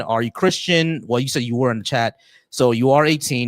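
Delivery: ordinary talk, nothing marked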